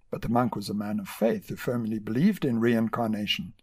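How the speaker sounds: usual speaking voice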